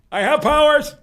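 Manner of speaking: high, loud grandiose boast; mock villain. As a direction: announce it like a cartoon sorcerer. Mock villain boast after yell.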